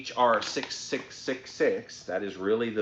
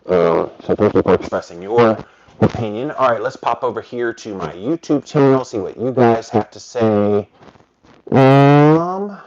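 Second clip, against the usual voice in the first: muffled; 3 semitones higher, 8 dB louder than usual. Real, not acted. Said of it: "If I'm muffled".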